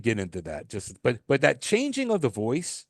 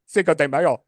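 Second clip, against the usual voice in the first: louder, higher mock Spanish accent; exaggerated Spanish accent; 4 semitones higher, 6 dB louder than usual. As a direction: burst into an over-the-top Spanish accent. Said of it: "they break into another accent".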